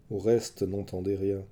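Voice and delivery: usual speaking voice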